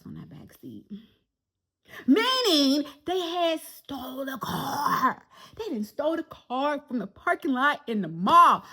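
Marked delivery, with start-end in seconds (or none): 2.0-8.6 mock despair. Real, not acted. Realising the car was stolen.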